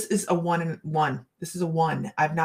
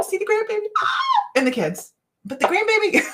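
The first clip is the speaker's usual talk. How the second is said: much higher, squealing delight on grandbaby; gushing grandma; 4 dB louder than usual. Real, not acted. Telling viewers about the grandbaby, high excited squeal.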